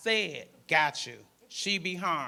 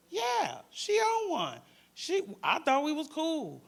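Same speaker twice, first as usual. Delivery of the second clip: high, louder indignant outburst; a teen girl venting, higher and loud; upset teen; 9 semitones higher, 5 dB louder, slower than usual. Retold or imitated. Teen girl venting to the crisis worker, indignant and loud.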